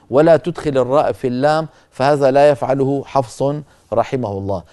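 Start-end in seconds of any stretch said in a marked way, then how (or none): none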